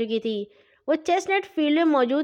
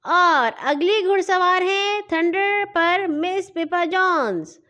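higher, slow booming announcer; loudspeaker announcement; 6 semitones higher, 3 dB louder, slower than usual. A narrator's reading. Announcer calls the next rider over the loudspeaker, higher and slow. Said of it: "loud speaker پر آواز گونج اٹھی".

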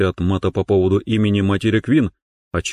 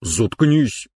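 growled; 3 semitones higher than usual. Acted. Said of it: "прорычал я".

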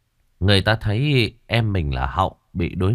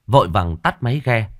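ordinary delivery

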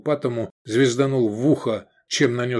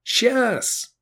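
much higher reply; calling back; 13 semitones higher than usual. A narrator's reading. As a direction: shout back on the move. Answering in battle.

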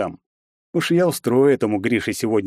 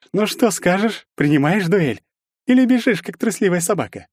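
high, provoking jeer; taunting goad; 10 semitones higher than usual. A narrator's reading. Bully pressing for an answer on the duel, taunting. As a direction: dare him and mock his courage.

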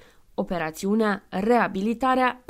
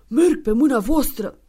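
put-on compliant old man; 3 semitones higher, 7 dB louder than usual. Santa agrees to mentors.

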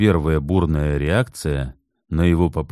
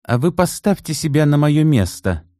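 much higher, sharp retort; snapping back; 10 semitones higher, 3 dB louder than usual. Acted. Assistant snapping back at Vereshchagin, defensive. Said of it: "огрызнулась помощница Терентьевой".

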